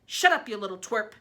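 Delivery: loud angry snap; aunt snapping at nephew. Acted. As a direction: bite it off at the boy. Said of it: "Aunt Spiker snapped".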